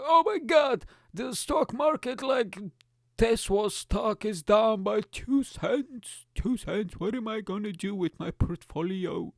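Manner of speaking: high-pitched, drawn-out whine of panic; hammed-up worried investor; mock stock panic. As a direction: fret over shares in a silly high voice. Acts out panicking over stocks.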